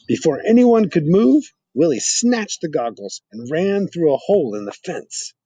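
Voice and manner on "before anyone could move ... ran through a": much higher, louder burst; dramatic. A narrator's reading. Narrating the sudden rescue, dramatic. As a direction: build sudden excitement.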